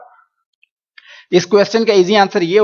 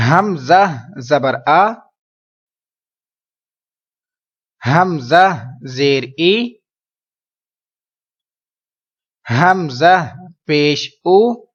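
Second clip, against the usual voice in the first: each syllable spelled out slowly for repeating; slow spelling drill; much slower than usual. Shown as a demonstration. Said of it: "آپ میرے پیچھے repeat کرتے جائیے". (آہستہ آہستہ ہجے کر کے پڑھانا)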